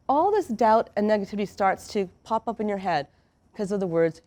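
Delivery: ordinary delivery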